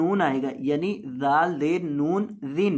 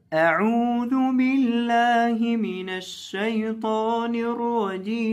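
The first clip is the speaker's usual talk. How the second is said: higher, slow melodic tilawat; Quran recitation; 4 semitones higher, slower than usual. Acted. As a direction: chant it as measured Quranic recitation. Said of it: "तिलावत के अंदाज में". (तिलावत के अंदाज में धीमा पाठ)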